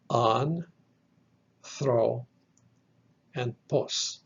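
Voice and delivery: "an, thro, and pos" as separate units, long pauses between; syllable-by-syllable demo. Shown as a demonstration. Teacher splits the word into three syllables, each said separately. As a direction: count off "an", "thro", "pos" one at a time. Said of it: "Three syllables".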